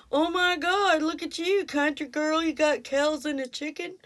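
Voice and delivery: mocking college kids. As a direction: squeal it like snooty classmates mocking her. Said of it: "and they were like".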